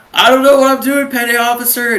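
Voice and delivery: high, loud recruit's blurted answer; hammed-up frantic boot-camp reply; mock panicked yell. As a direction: shout back like a flustered recruit. Acted panicked recruit shouting answer.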